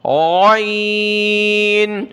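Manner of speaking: very slow, heavy عین from the throat; heavy throaty ain. Shown as a demonstration. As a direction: stretch it thick from the throat. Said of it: "موٹا پڑھیں گے اور اس کو گلے سے نکالنا ہے".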